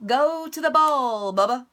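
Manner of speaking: mysterious voice. Acted. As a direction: call him from nowhere. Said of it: "said the voice".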